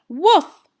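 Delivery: loud high yappy woof; dog bark. Acted. Dog barking at Miss Curie, loud high woof. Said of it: "barked Einstein".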